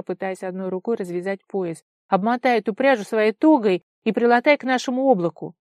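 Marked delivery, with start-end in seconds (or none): 2.1-5.5 urgent order. A narrator's reading. Urgent orders in wind, after snapping.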